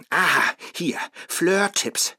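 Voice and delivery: usual speaking voice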